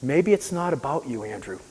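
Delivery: soft, hushed voice, trailing away; inner voice. Retold or imitated. A mysterious voice answers the patient in bed, hushed. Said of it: "I heard a voice say".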